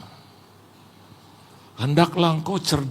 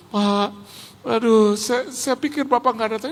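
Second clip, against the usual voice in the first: imitating tearful relief; 5 semitones higher than usual. Retold tearful pastor hugging guest.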